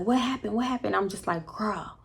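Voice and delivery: soft, hushed talk; hushed whisper. Real, not acted. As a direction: friends whispering in the car during the fight, hushed. Whispered exchange in the car.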